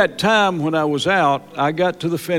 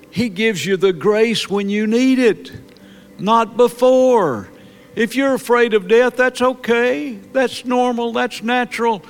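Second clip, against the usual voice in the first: high pleading preaching; 7 semitones higher than usual. Real, not acted. Pastor reassures congregation afraid of death, high pleading preaching. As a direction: lift the voice to comfort the fearful.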